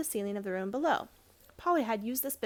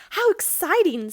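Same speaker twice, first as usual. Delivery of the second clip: higher, louder and drawn out; thrilled girl; 8 semitones higher, 10 dB louder, slower than usual. A narrator's reading. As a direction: gasp it out in delight. Polly thrilled by the mystery.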